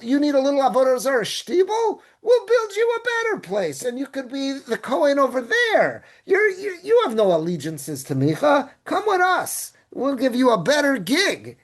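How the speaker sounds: high, eager sales pitch; coaxing salesman. Retold or imitated. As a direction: sell him the deal, high and keen. Tribe coaxing the Kohen.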